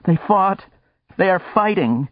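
high, tearful woman's voice; a weeping stranger, high and strained. Acted. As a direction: sob it out through tears. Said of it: "She was weeping".

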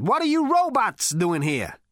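brash, swaggering space rogue; cocky Zaphod. A narrator's reading. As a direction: sneer it at the robot. Zaphod's character voice.

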